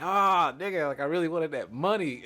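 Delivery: loud, high groan of losing out; retold cry of losing the bet; dismay.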